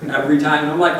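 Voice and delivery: ordinary talk, nothing marked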